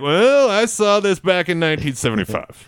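put-on high-pitched old-timer voice; old guy manager. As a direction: imitate an old ballplayer reminiscing. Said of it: "Two old guy managers".

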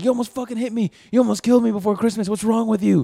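high, hurried mock cop tirade; imitated angry yelling. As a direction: berate her like an outraged traffic cop. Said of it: "he's just yelling at her, like, intimidating".

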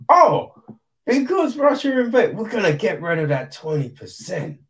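high, loud, fast parody of a company excuse; mock corporate voice. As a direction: ham up the hollow PR excuse, starting high. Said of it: "they're like".

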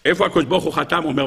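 ordinary delivery

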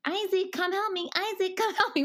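high, sing-song nag repeated like a broken record; nagging mom voice. Retold or imitated. Mom nagging her son to help, high sing-song. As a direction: call the kid over and over, whiny and high. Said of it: "what I feel like I sound like this thing, on repeat".

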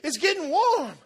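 high, loud and amazed, slowing at the end; amazed excitement. Retold or imitated. Woman feeling her knee heal, crying out high and loud. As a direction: marvel out loud as the heat spreads. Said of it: "And she goes".

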